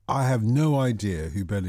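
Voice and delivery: ordinary talk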